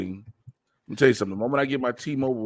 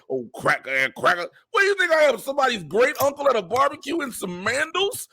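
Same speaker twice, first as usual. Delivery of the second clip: much higher and louder, hammed-up; squawking higher caricature voice; mock caricature; 4 dB louder than usual. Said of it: "Hey, look".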